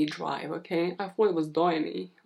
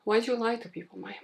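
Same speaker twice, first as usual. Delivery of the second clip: higher-pitched self-reproach; mock scolding; 4 semitones higher, 3 dB quieter, much faster than usual. Host scolding herself for broken promises, mock reproach. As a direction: playfully scold yourself in a raised pitch.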